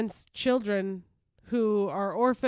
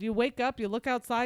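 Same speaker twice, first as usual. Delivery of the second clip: ordinary delivery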